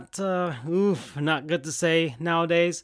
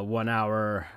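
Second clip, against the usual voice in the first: plain reading voice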